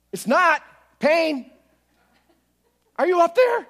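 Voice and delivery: high, loud cry to God; desperate shout. Retold or imitated. Man in pain shouting up to an unanswering God. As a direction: call out as if God is far away.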